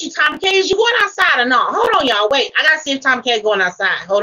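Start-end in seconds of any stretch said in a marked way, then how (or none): none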